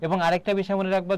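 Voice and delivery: normal talking voice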